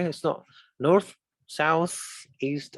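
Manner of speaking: usual speaking voice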